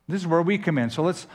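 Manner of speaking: ordinary talk, nothing marked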